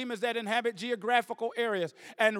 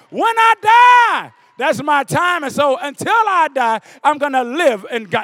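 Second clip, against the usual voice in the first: shouted, much louder sermon climax; full-force preaching; 2 semitones higher, 15 dB louder than usual. Acted. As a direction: roar it out to the whole congregation.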